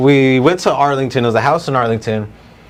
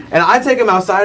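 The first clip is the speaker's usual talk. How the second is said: usual speaking voice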